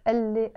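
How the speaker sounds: قال لي fused into elle, no Q; as one word. Shown as a demonstration. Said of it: "always pronounce this". (blend قال لي into elle)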